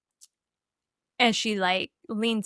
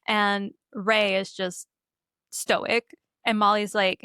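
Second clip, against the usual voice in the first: normal talking voice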